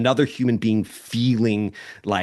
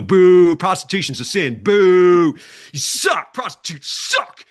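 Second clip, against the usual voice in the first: higher, louder jeering heckler; mock booing; 7 semitones higher, 6 dB louder than usual. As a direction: boo from the crowd like a self-righteous heckler. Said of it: "booing and go".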